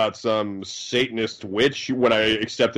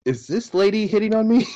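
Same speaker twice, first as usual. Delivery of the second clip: high mock voice; 9 semitones higher than usual. Mock swooning reaction to movie.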